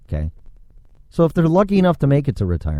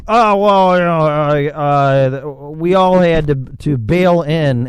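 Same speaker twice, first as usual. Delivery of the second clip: weary higher voice, slowing on "bail in"; imitating trucker; 4 semitones higher, 4 dB louder, slower than usual. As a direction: voice a fed-up driver explaining. Voicing the upset trucker.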